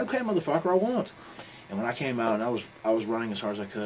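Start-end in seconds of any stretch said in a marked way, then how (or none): 0.0-1.1 much higher, fed-up mock reply; high exasperated retort. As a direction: retell an irritated comeback, voice pitched way up. Retold exasperated retort to nagging.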